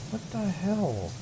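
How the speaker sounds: high, slow incredulous "what the hell"; drawn-out, high-pitched bafflement; baffled. Real, not acted. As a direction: stretch it out in disbelief. Confused at stubborn dirt.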